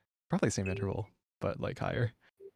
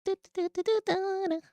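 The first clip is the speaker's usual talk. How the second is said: put-on high sung anime girl voice; high cute anime girl; 3 dB quieter than usual. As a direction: sing it like a squeaky anime girl. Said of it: "weeb voice".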